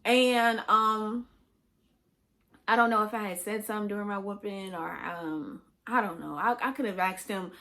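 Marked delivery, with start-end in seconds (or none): none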